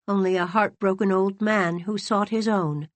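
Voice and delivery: usual speaking voice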